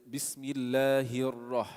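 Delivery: Bismillahir Rah slowly, the ra rolled lightly; slow recitation voice; flowing ra. Shown as a demonstration. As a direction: roll into Rah without pausing. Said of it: "You don't pause".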